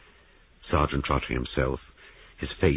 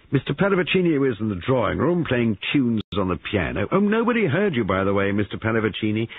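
higher, lightly voiced brisk investigator; police sergeant questioning; 7 semitones higher, 5 dB louder than usual. A narrator's reading. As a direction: check each account in a mildly official voice.

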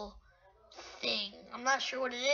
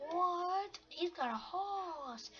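astonished onlookers; 4 semitones higher, 8 dB quieter, much slower than usual. Retold or imitated. Voices astonished others.

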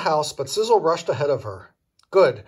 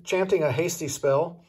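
ordinary delivery, nothing marked

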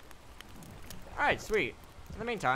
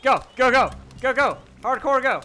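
loud, high, frantic go-go-go; shouting orders over battle; 7 semitones higher, 10 dB louder than usual. Real, not acted. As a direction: yell the troops forward.

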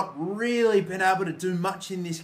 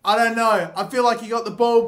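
ordinary talk, nothing marked

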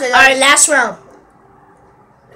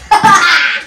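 loud laugh; 4 semitones higher, 8 dB louder than usual. Real, not acted. Laughing after accusing.

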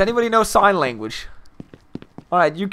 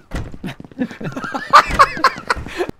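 high, wheezy-free burst of laughter; laughing fit; 10 semitones higher than usual. Real, not acted. Laughing at own joke.

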